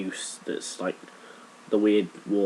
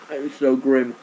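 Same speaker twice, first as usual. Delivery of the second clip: loud, high, disgusted wail; disgusted cry; 5 semitones higher, 7 dB louder than usual. Real, not acted. Reacting to eating raw leek.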